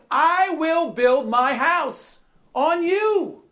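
high, drawn-out preacher's proclamation; emphatic proclamation. Acted. Preacher quoting Jesus's promise to Peter, emphatic proclamation. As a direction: proclaim it slowly with rising pitch. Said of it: "Jesus says".

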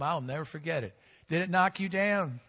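usual speaking voice

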